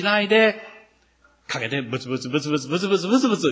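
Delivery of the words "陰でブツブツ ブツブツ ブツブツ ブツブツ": muttered under the breath in a put-on grumble; mock muttering. The crowd grumbling behind Jesus's back, muttering. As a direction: mumble it like someone grumbling behind his back.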